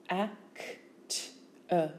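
Act-er as separate phonemes a, c, t, er; sound by sound. Shown as a demonstration. Teacher showing phonemes, Act-er sound by sound.

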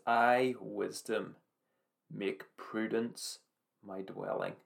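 slow, each phrase weighed; slow deliberate verse. Acted. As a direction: lay the key verse down word by word.

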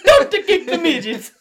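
very high, very loud hammed-up shriek; shrieked plea. Redoing Luigi's plea as he is picked up, shrieked. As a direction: scream it in a comic falsetto panic. Line repeated far louder amid laughter.

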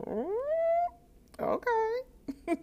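very high, small "oh, okay"; meek acceptance. Retold or imitated. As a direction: squeak out a taken-aback okay. Meekly accepts curt order.

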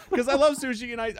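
high and loud through laughter; laughing while defending himself. Real, not acted. Explaining himself mid-laugh.

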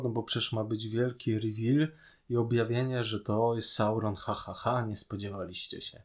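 usual speaking voice

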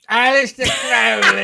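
very loud, high, hammed-up British accent; mock British accent. Co-host repeating the name because it's fun, exaggerated British accent. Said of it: "it's just too fun to say like that".

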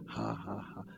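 very low, soft forced laugh; faith laugh. Shown as a demonstration. Preacher brushing off that other problem, deliberate faith laugh. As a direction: dismiss it with a deliberate ha-ha. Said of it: "Laugh in faith".

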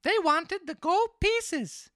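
very high, loud puppet voice; marionette voice. Acted. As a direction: pitch it way up as the naive little marionette. Pinocchio's reply in story.

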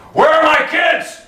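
sudden high, loud shout as a frantic Abram; a worried father yelling for his children; shouted question. Acted. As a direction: bellow it like a father missing his kids. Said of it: "I should tone it down".

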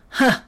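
loud, high gleeful "ha"; one sudden bark of laughter; hyena laugh. Acted. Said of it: "said the hyena".

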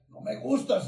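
high, loud hostile snarl; the possessed man rejecting the preacher, loud and high; snarling demon. Retold or imitated. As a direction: spit it out like an angry demon.

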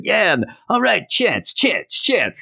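high-pitched imitation of a cheering crowd; mock crowd cheering. Palace crowd cheering for Chance, mock cheer. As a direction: chant it high, like fans cheering a winner. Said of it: "Everybody's cheering".

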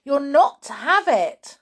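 insistent protest; crying out. Acted. George warns Grandma off the cup, urgent. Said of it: "George cried out".

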